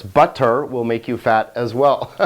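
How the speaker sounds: ordinary delivery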